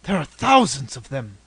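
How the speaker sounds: loud, aghast stage whisper; hushed horror bursting out on the number; horrified whisper. A narrator's reading. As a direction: gasp it out, stunned. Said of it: "whispered Stanley".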